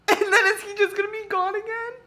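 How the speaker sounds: high, crying half-laugh; tearful protest. Real, not acted. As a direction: whine it through tears and laughter. Upset reader fears losing character.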